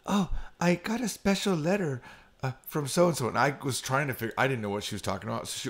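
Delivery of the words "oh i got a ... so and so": lightly high, sweet maid voice; imitating a maid. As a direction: mimic a cafe maid's chirpy announcement. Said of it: "but they're like".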